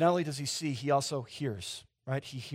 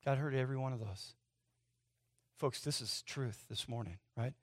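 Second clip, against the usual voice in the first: hushed and sincere; 4 semitones lower, 6 dB quieter than usual. Real, not acted. Hushed solemn climax.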